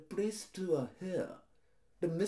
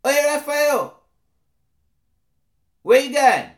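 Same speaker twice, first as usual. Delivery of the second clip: loud, higher calls across the street; brash roadman hailing a friend, loud; shouting after someone; 5 semitones higher, 14 dB louder than usual. Acted. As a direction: bellow his name to stop him. Calling out to a passerby.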